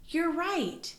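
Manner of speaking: high delighted cheer; excited praise. Acted. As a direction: gasp then cheer them on. Praises child's answer.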